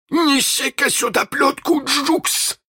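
high commanding pirate captain; captain's order. Acted. Hook orders Jukes to fetch the whip, high and commanding. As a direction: give the order with cruel relish. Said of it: "приказал Крюк".

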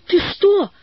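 put-on startled storybook child; hasty, alarmed question. A narrator's reading. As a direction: ask it in sudden alarm, pitch jumping. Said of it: "поспешно спросила Валя".

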